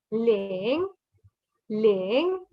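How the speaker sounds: "ling" starting low and gliding up; rising tone. Shown as a demonstration. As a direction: demonstrate "ling" with a rising pitch. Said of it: "you go from low to high".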